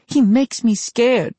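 put-on high, slow, fearful boy; small frightened confession; scared boy. Boy admits fear.

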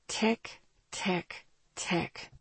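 hushed, spaced-out countdown; ominous ticking. A narrator's reading. Narrator closing the chapter on a timer, ominously hushed. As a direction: tick softly like a timer running out.

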